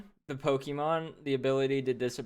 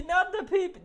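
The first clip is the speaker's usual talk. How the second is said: mock panicked shriek. Laughing at the streamer's joke with a much higher hammed-up shriek. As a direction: cry out "Not the pee-pee!" like a cartoon victim.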